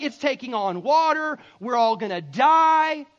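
loud panicked cry of alarm; freaking out. Acted. Disciples on a sinking boat, freaking out. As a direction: cry out loudly, stretching the last words. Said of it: "it says they're freaking out".

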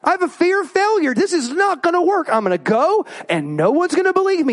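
playing Moses sure he'll fail; panicky Moses. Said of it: "Next he goes".

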